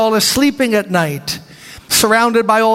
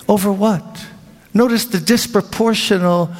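ordinary talk, nothing marked

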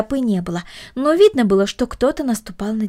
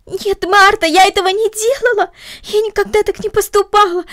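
upset pleading; 11 semitones higher, 5 dB louder than usual. Acted. Girl runs to Marta upset.

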